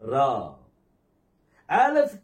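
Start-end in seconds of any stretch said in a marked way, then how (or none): 0.1-0.5 ரா with a sustained aa, lower; long vowel. Shown as a demonstration. Teacher repeating the long sound for students, long vowel. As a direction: hold the aa of ரா. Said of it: "நீண்ட துணி வரும்".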